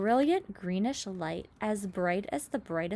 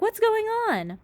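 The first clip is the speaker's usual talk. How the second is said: alarmed cry; 6 dB louder than usual. A narrator's reading. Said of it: "cried James".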